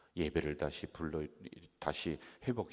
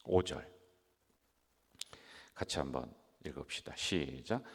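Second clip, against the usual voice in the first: normal talking voice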